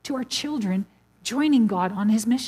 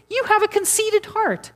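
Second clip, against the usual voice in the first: higher mock accusation; hammed-up sneering sibling; accusing brother; 10 semitones higher than usual. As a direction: squeak out the brother's put-down.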